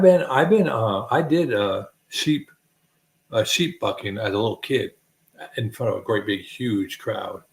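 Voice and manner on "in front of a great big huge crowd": ordinary talk, nothing marked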